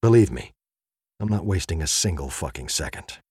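usual speaking voice

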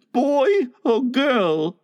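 put-on high, slow small-boy question; not too hopeful. A narrator's reading. Michael asks his pretend mother, not too hopefully. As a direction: ask slowly in a high voice, hope held back. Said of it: "not too hopefully".

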